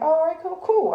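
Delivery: "cool" squeaked way up and loud in mock agreement; sarcastic sing-song "All right, cool"; sarcastic high "cool". Answering partner's advice with sarcastic high agreement.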